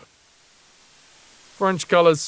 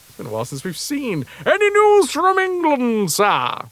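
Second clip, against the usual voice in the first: higher, stiff naval officer voice; formal sailor voice; 9 semitones higher, 4 dB louder than usual. Acted. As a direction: report to the captain politely. Reading ship news aloud.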